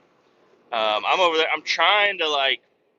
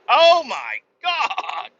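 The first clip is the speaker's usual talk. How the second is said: much higher, louder, slow hammed-up panic with a cry, into laughs; panicked shriek; 9 semitones higher, 3 dB louder, slower than usual. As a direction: shriek at the man who shot you. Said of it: "I'm like".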